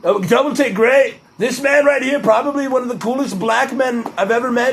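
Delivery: high, boastful presidential parody; Donald Trump impression. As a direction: praise a man like a rally showman. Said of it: "Donald Trump impression".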